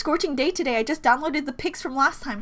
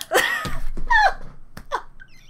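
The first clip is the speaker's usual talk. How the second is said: shrieking laugh. Real, not acted. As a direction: let out an overexcited shriek of laughter.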